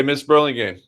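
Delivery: ordinary talk, nothing marked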